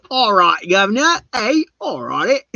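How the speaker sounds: high, loud hammed-up Cockney; Cockney accent. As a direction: put on a broad, high-pitched Cockney. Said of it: "is it Cockney?".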